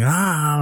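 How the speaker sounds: a high startled "ah" while floating; high mock yelp. Travis floating helpless in zero gravity, a high yelp. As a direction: yelp like losing control in the air.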